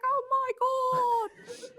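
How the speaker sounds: high, drawn-out Australian wail; Australian accent. Retold or imitated. Imitating her Australian roommate during the bat scare, Australian accent. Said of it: "Australian. She's like".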